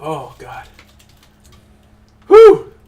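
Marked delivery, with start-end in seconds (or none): 2.3-2.7 loud high whew after a strong sip. Real, not acted. After a strong sip of alcohol, a big loud whew.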